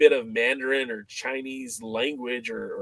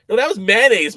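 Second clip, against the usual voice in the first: normal talking voice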